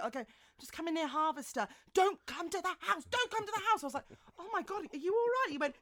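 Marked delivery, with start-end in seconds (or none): 0.6-3.8 high, loud, urgent warning; retold panic, high and loud. Retold panicked phone call. 4.4-5.5 high, fast, alarmed asking; quick startled concern, pitched up. Retold or imitated. Alarmed reply to friend.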